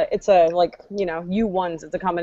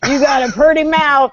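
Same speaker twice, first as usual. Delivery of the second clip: slow, higher backwoods drawl; hillbilly accent; 4 semitones higher, 4 dB louder than usual. Pretend. Parodying things hillbillies say, hillbilly accent. Hillbilly-say examples being acted.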